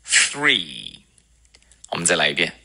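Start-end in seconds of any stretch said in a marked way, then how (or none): 0.0-1.0 three slowly, real th, curled R, long ee; tongue between teeth. Shown as a demonstration. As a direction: draw out three with a falling long ee. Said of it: "要咬舌头".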